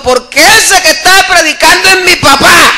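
high, shouted retort; retold protest at a high pitch; mock indignant shout. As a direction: imitate a heckler blurting out the punchline. Heckler shouts his reason, retold.

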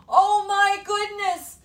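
high, loud and slow amazement; shocked exclamation. Acted. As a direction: gasp it out in wonder. Narrator reacts to the scene.

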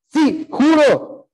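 high, drawn-out emphatic oath; punchy, high-pitched vow; sure and forceful. Acted. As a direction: swear it loud and slow with total certainty. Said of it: "sale durísimo".

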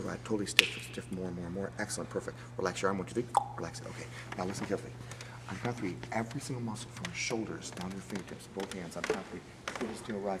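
soft, low hypnotist's suggestion; hypnotic voice. Acted. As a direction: lull him under with quiet commands. Hypnotist inducing trance.

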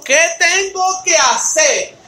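slow, deliberate reading of the verse; slow solemn scripture reading. Shown as a demonstration. Preacher slowly reading the jailer's question and Paul's answer, solemn. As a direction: let each word of the promise land slowly. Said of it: "Mira lo que dice".

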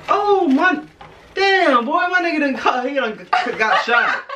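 high, shocked outburst. Real, not acted. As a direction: gasp it out in disbelief. Shocked at broken gingerbread man.

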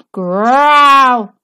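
loud rumbling belly growl; tummy growl. A narrator's reading. The wolf's hungry tummy rumbles, rumbling growl. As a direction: rumble like an empty stomach.

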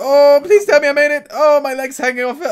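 loud, high-pitched dismay; alarmed pleading. Real, not acted. Player unsure he survived the crash, loud high pleading. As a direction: cry out, desperate to have made it. Gruesome crash near the game finish.

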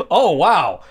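usual speaking voice

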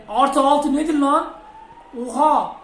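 loud, high, drawn-out disbelief; indignant disbelief. Real, not acted. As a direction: balk loudly at the added time. Shocked at added time.

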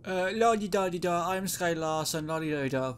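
put-on light sing-song Irish accent; breezy Irish accent, pretending to be the owner. Player fakes being the owner in a light Irish accent. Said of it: "Why did I go an Irish accent?".

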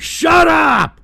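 screamed shut up, high and loud; angry roar; furious shout. Real, not acted. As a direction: yell at the troll to shut up. Streamer yelling at TTS troll.

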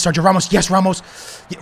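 ordinary talk, nothing marked

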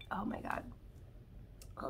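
whispered, winded after coughing; breathless whisper. Real, not acted. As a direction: gasp it out, spent.

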